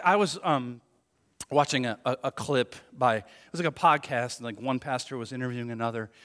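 ordinary talk, nothing marked

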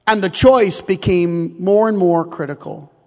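ordinary delivery

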